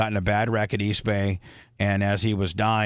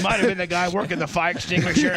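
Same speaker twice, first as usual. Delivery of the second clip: talking through laughter, higher pitched; laughing; 6 semitones higher than usual. Real, not acted. Joking to the crew about the wreck rescuer, laughing. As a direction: crack up while getting the words out.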